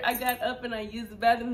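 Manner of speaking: usual speaking voice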